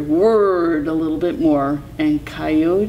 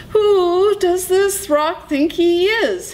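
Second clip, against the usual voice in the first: high, drawn-out indignant coyote; indignant thought; 14 semitones higher, 3 dB louder than usual. A narrator's reading. Coyote fumes at the rock, indignant. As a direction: demand it high, affronted. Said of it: "he thought".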